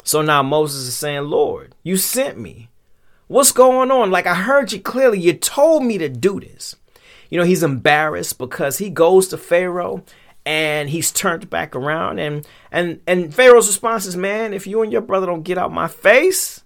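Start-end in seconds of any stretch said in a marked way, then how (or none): 1.2-6.8 high, loud pleading protest; exasperated complaint. Acted. Moses tells God he only followed orders, exasperated. 14.2-16.6 high-pitched, fed-up rebuff; irritated dismissal. Acted. Pharaoh throws Moses and his brother out, irritated.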